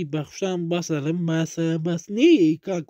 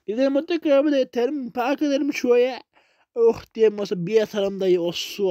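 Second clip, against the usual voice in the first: normal talking voice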